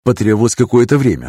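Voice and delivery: plain reading voice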